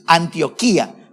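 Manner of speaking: slow Antioquía with a stressed í; accent on the I. Shown as a demonstration. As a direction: draw out Antioquía as an-tio-KEE-a. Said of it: "con tilde en la I".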